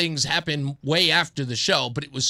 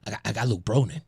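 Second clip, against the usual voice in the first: friend on phone; 8 semitones lower, faster than usual. Retold or imitated.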